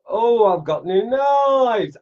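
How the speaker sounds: high, slow, delighted shout over the new knives; excited shout. Real, not acted. Carver opening a box of new knives, thrilled shout.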